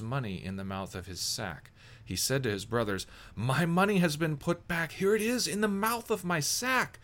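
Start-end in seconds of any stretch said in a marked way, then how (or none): 3.4-7.0 high, loud cry of astonishment; shocked outburst, raised and high; alarmed discovery. A narrator's reading. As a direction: call out in startled alarm, pitch climbing. Brother discovers returned money, alarmed.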